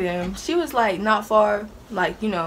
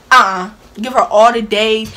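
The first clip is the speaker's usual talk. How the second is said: loud flat uh-uh; loud no-way verdict; firm refusal; 9 dB louder than usual. Retold or imitated. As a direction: shut it down loudly. Refusing leniency.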